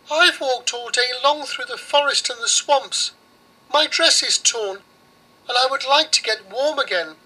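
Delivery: put-on high weary little girl; girl's voice. Girl tells the witch of her long day, high. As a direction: plead a little, high and tired. Girl answers Baba Yaga.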